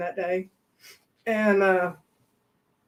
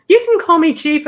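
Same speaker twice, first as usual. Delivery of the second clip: mock pompous; 7 semitones higher, 8 dB louder than usual. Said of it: "He said".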